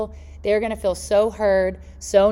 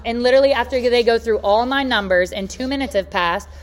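ordinary talk

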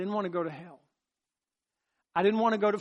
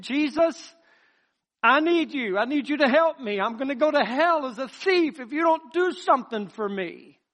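pleading prayer; 6 semitones higher, 5 dB louder than usual. Retold or imitated. Retold child's desperate prayer.

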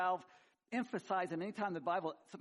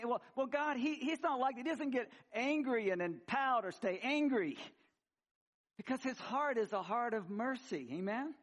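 raised, fuller preaching voice; fervent preaching; 7 semitones higher, 5 dB louder than usual. Real, not acted. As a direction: preach it with fire. Preaching at full force.

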